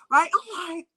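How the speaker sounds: ordinary delivery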